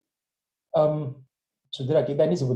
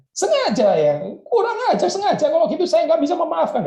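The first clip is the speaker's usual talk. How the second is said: much higher, louder indignant outburst; mock outrage; 14 semitones higher, 4 dB louder than usual. Joseph flaring up at the brothers' admission. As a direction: flare up in hammed-up offence. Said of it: "jadi ribut lah ini ya".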